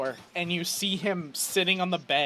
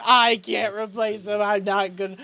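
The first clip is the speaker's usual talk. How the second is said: crying; 4 semitones higher, 4 dB louder than usual. Acted. Chet panics that nobody can bring them back, wailing. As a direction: cry out in despair, swelling louder. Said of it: "like, crying".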